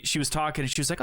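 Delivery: ordinary talk, nothing marked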